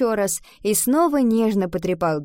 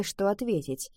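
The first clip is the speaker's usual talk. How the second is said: usual speaking voice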